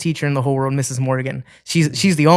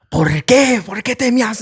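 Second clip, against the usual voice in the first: scolding mom; 8 semitones higher, slower than usual. Retold or imitated. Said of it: "My mom was like".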